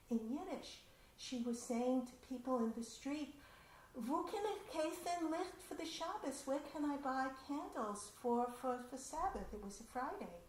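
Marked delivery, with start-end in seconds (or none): 4.0-9.5 immigrant woman asking. Retold or imitated. Immigrant woman asks passers-by.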